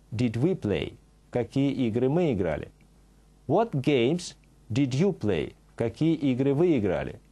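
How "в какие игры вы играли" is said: ordinary talk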